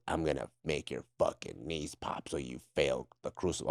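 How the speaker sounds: low, soft threat hissed into the ear; menacing whisper. Retold or imitated. Drill instructor whispering a threat into recruit's ear, low and soft. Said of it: "he whispers in my ear".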